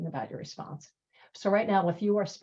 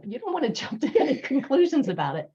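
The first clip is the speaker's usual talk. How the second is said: higher and louder, laughing mid-sentence; laughing through it; 7 semitones higher, 5 dB louder than usual. Real, not acted. Teacher amused by her own example, laughing as she speaks. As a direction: giggle your way through the warning.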